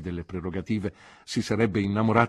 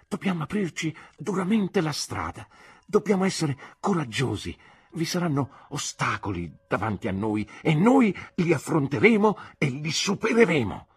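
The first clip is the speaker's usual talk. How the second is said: growing fervor; 8 semitones higher, 5 dB louder than usual. A narrator's reading. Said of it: "riscaldandomi sull'argomento".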